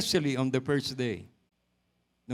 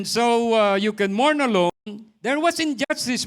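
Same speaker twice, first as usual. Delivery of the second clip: louder declaration to the congregation; preaching loud; 6 semitones higher, 7 dB louder than usual. Acted. Preacher proclaims to congregation, loud and strong. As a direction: drive the point home to the church.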